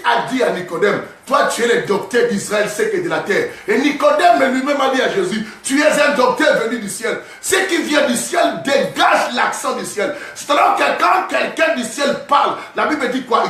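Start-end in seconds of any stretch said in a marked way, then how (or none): 3.7-13.4 fiery preaching. Acted. Preaching at full force.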